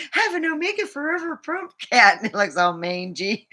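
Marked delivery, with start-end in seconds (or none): 0.1-2.2 much higher, fast, louder ad voice; mock sales pitch. Selling imaginary cat toys to viewers, high fast pitch. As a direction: pitch it like a goofy toy ad.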